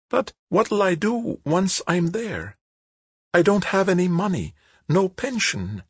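a frail lady suddenly troubled; worried old woman. Acted.

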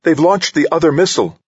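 very high-pitched alarmed report; urgent alarm. A narrator's reading. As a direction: call out the threat urgently, high-voiced. Crew member alarmed by missile launch.

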